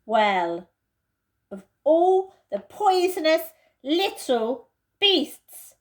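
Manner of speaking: Peter's disgust. Acted. Peter condemns Edmund for his lie, disgusted. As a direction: spit out your contempt.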